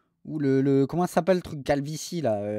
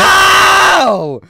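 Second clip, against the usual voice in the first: loud, high startled yelp; startled shout; 16 dB louder than usual. Real, not acted. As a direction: yelp as the mic blasts you.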